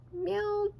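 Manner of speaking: meowed high like a cat; a playful cat meow on the name. Acted. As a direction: stretch the word into a cat's meow. Narrator voicing cat sound.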